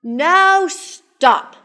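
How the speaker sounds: high, loud halting order; Max's command. A narrator's reading. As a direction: call a firm halt to the monsters. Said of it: "Max said".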